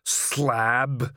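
troll growl on one word. A narrator's reading. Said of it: "he growled".

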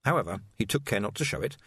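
usual speaking voice

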